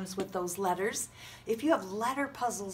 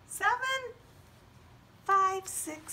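very high squeaky counting; high puppet voice; slower than usual. Acted.

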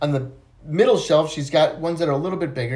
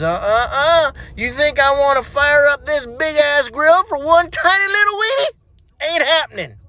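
sassy refusal; 10 semitones higher, 4 dB louder than usual. Acted. Susie refuses Johnny sassily.

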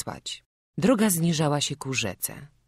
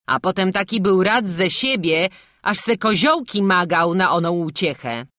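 higher, louder insistent voice; stubborn village gossip; 5 semitones higher, 7 dB louder than usual. Acted. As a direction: press the argument hard. Guest argues devil took shape.

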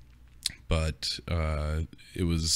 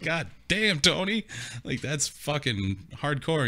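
amazed friend; 9 semitones higher, slower than usual. Retold or imitated. Friend's amazed reaction voiced.

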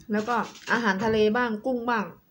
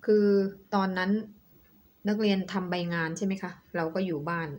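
normal talking voice

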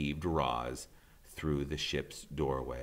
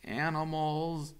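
higher, slow robot voice calling out; robot's farewell call; 11 semitones higher, 5 dB louder, much slower than usual. Acted. Roz calling goodbye to the island animals, high and slow. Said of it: "Roz's voice echoed".

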